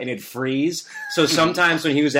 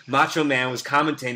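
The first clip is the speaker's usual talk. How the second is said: normal talking voice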